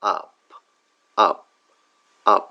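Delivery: আপ with a short open vowel, said slowly; example word demo. Shown as a demonstration. Teacher modelling the word up. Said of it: "একটা example যদি আমরা দেখি".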